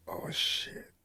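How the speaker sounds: very soft, voiceless "uh-oh" aside; whispered dismay; hushed realization. Retold or imitated. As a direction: breathe it out under your breath. Retelling his dawning, horrified realization.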